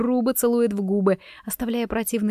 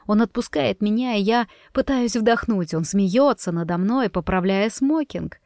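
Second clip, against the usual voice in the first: ordinary delivery, nothing marked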